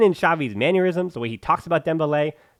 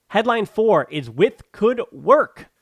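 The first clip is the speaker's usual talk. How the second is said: slow, higher and louder, dripping with sarcasm; sarcastic question; 6 semitones higher, 4 dB louder, much slower than usual. Real, not acted. Host reading a headline to fans, slow sarcastic question. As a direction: drawl the headline like you doubt it. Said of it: "with a lot of sarcasm".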